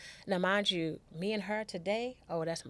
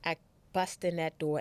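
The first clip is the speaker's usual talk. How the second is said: normal talking voice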